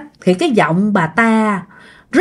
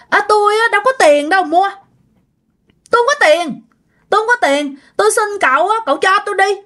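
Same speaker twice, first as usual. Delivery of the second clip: put-on high, wheedling young woman's voice begging for a favour; pleading young woman; 9 semitones higher, faster than usual. Old woman at the door begs the young man for his mattress cover, high and wheedling. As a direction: beg sweetly, voice pitched up like a girl of eighteen. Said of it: "giọng nói này giống như là người tầm khoảng chừng mười tám".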